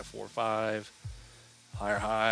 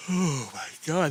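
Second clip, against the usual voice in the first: words stretched by a yawn; 7 semitones higher, 7 dB louder, slower than usual. Real, not acted. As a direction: talk through a big yawn. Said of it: "there goes another yawn".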